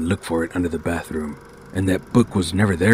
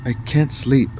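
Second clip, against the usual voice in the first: put-on higher, louder kid voice; young boy voice; 7 semitones higher, 4 dB louder than usual. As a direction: plead like a sleepy kid. Boy asks mom quietly.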